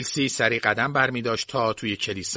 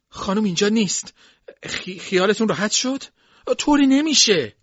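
high, raised voice with a slight stumble; agitated servant; 10 semitones higher, 5 dB louder than usual. A narrator's reading. Servant tells the mistress the room is empty, agitated. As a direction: insist that all is fine, pitched up high.

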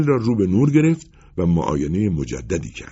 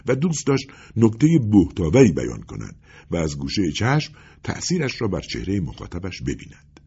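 ordinary delivery

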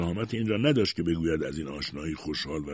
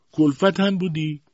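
high, loud, drawn-out question; imperious interrogation; 12 semitones higher, 9 dB louder than usual. A narrator's reading. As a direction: grill her like a haughty aunt. Aunt interrogates mother.

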